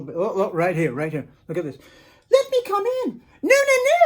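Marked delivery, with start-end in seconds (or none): 2.3-3.2 high squeaky pleading pig voice; a pig begging at the wolves' door, high and wheedling. Acted. 3.4-4.0 high tiny voices saying no; three little wolves refusing the pig, high and squeaky. Acted. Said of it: "said the three little wolves".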